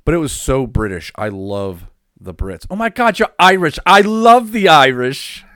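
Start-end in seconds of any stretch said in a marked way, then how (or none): 2.7-5.4 loud, high hammed-up excitement; mock gushing. Fan raving about loving the Irish, mock gushing. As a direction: gush in a high, put-on voice.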